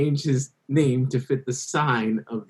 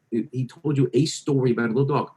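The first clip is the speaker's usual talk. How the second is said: ordinary talk, nothing marked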